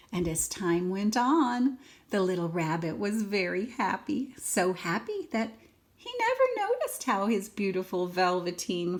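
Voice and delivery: put-on lively read-aloud for a small child; expressive storybook reading; story narration. A narrator's reading. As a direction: read it like a bedtime picture book. Reads picture book to child.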